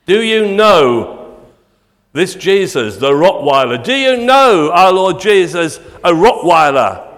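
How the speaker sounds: preacher playing scandalised; mock outrage. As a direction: boom it out in pretend shock.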